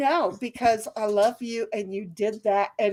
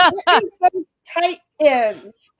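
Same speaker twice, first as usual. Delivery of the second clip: slow, louder, stress on tight; stressed phrase; 4 semitones higher, 5 dB louder, slower than usual. Shown as a demonstration. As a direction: spell out "tight ends" with mock insistence. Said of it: "Get the verbiage right".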